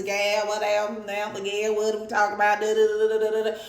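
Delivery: hammed-up Texas accent trailing into nonsense syllables; mock Texas accent. Mocking how Texans talk, put-on Texas accent. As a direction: do a caricature Texan. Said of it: "You from Texas".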